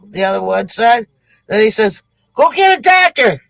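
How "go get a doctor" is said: high-pitched comic cry for help; urgent plea. Acted. Bitten gold digger to his partner in the desert, urgent plea. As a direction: send your friend off urgently.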